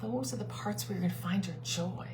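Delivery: usual speaking voice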